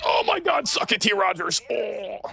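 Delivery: very high-pitched excited shout; gloating outburst. Real, not acted. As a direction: crow over the kill in a squealing high voice. Gloating outburst after a game kill.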